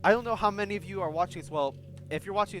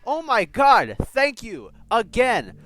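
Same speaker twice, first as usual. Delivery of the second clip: much higher, very loud cheering shout; ecstatic yelling; 6 semitones higher, 13 dB louder, much slower than usual. Real, not acted. Fan cheering the hero's line, very loud. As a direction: scream your thanks at the screen. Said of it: "after yelling all that shit".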